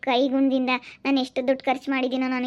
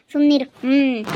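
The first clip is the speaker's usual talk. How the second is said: loud scold; 7 dB louder than usual. Acted. Girl scolding scared suitor.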